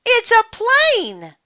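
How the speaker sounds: high, drawn-out excited shout; amazed high yell. A narrator's reading. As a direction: call out a sighting, slow and loud. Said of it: "Neil shouted".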